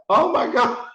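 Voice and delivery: high-pitched squealed reaction; mock dismay. Guest hearing his mother booed, mock dismay. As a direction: replay your dismay in falsetto. Own panicked reaction to the booing.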